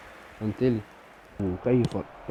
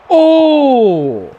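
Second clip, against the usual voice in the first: drawn-out gasp; 6 semitones higher, 4 dB louder, much slower than usual. Real, not acted. Exclaiming at a game moment.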